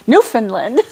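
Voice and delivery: "Newfoundland" as NEW-fin-lin through a laugh; giggly "Newfoundland" said Newfinlin, rising; practising Newfinlin. Shown as a demonstration. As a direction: say "Newfoundland" as Newfinlin while cracking up. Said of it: "still going over".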